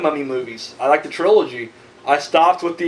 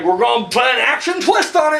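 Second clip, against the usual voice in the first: mock announcement; 4 semitones higher than usual. Mimicking studio bosses announcing their plan, mock hype shout. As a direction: shout it like an overhyped pitch.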